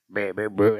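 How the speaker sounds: low growly mock-demon babble; a hint of a possessed growl; possessed baby growl. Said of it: "sound like that possessed person".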